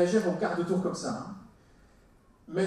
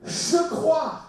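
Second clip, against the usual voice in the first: high, louder burst of belief; impulsive cry; 8 semitones higher, 5 dB louder than usual. Acted. Father answering Jesus in the retold story, impulsive cry. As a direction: blurt it out without thinking.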